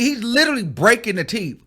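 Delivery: normal talking voice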